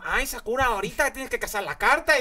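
high, hammed-up Mexican Spanish accent; a comedian aping a Mexican dub of a yellow plush guardian; mock Mexican accent. The guardian plush voiced in a mock Mexican accent. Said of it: "es como".

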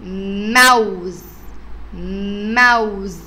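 put-on teacher drawing out موز so kids hear the م; slow drawn-out word. Shown as a demonstration. Teacher stretches موز for the children, slow and drawn out. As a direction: stretch each sound of موز slowly. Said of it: "أول شكل معايا".

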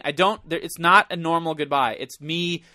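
ordinary talk

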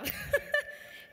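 a high breathy giggle. Real, not acted. Speaker confesses hitchhiking, a high breathy giggle. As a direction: giggle at admitting she hitchhiked.

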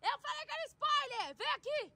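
very high, urgent cry; frantic yell. Real, not acted. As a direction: call a friend back in panic. Scolding friend in the dark. (grito agudo e aflito)

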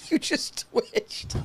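slow, wheezing through laughter; breathless laughing. Real, not acted. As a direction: gasp it out mid-laugh.

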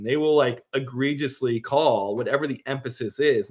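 usual speaking voice

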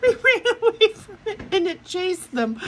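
high squeaky voice through real laughter; cracking up while talking; laughing. Real, not acted. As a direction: barely get it out through helpless laughter. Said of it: "I shouldn't be laughing".

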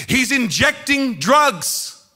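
high, drawn-out cry; imitated screaming mother. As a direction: cry it out slowly as a horrified mother. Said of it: "she's screaming".

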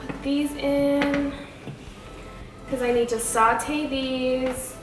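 usual speaking voice

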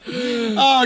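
ordinary delivery

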